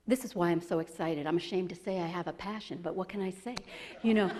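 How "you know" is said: ordinary delivery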